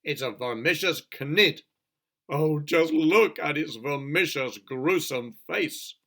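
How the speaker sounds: loud, slow horrified cry; terrified police chief crying out; cried out in fright. Acted. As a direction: recoil in horror as you say it. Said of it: "cried the chief police".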